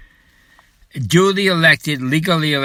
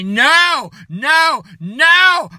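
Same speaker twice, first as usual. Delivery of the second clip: defiant shout; 10 semitones higher, much slower than usual. Acted. Rallying people to refuse the carrier, shouting. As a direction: shout it down, building.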